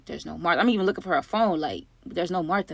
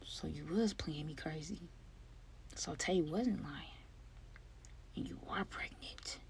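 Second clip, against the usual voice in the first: slow, hushed whisper; dramatic whispered aside after a shock; stunned whisper; 2 semitones lower, 21 dB quieter, slower than usual. Acted. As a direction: whisper each realization slowly. Hushed realization after the gasp.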